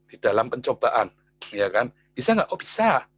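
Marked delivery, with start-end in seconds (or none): none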